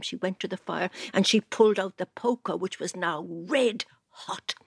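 ordinary delivery

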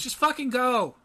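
high-pitched exasperated dismissal. Acted. Heroine sends the teleporter away before police arrive, exasperated. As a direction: send him off, fed up. NPC rolls eyes, dismisses him.

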